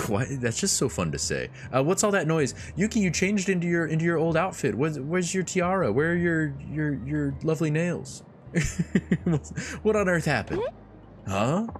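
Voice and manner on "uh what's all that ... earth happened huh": put-on high, bright-eyed girly voice; Yuki voice. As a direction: play the cheerful hostess, pitched up. Yuki's lines voiced high.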